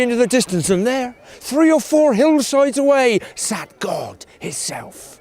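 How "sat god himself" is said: low, soft and slow; slow awed hush; hushed reveal. A narrator's reading. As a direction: drop the voice for God's entrance. Narrator reveals God.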